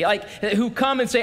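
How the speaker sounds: normal talking voice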